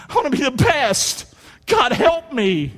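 high, shouted plea to God; passionate prayer. Acted. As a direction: cry out for help, drawing out the last words. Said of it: "having that kind of passion".

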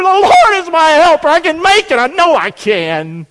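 high, loud desperate declaration, easing at the end; acted scream. Preacher acts out a broken believer calling out, acted scream. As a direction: scream it out, then settle on the last words. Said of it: "You just scream".

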